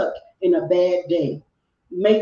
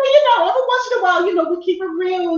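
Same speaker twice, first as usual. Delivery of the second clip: mock over-the-top voice; 12 semitones higher, 6 dB louder, much faster than usual. Teasing back after roast accusation.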